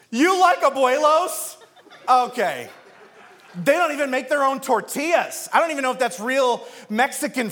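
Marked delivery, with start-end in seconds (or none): 0.1-2.7 mock incredulous. Mock outrage over restaurant.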